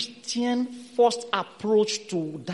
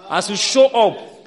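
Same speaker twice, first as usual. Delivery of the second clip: ordinary delivery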